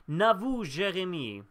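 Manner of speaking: put-on name said slowly and loudly in a French accent; playful French accent on the name. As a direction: announce him like a French host, drawn out. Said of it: "I did your name in a French accent".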